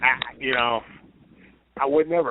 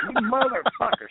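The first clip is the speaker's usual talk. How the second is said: mock outrage; 10 semitones higher than usual. Retells outrage at being kissed.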